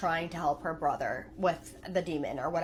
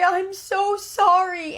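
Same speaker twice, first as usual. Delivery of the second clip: put-on high little girl voice, drawn out very slowly and louder; slower crying apology; 12 semitones higher, 10 dB louder, much slower than usual. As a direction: let the second sorry stretch and break into a sob. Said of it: "and she's just crying".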